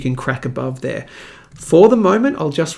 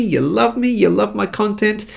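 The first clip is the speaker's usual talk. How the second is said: ordinary delivery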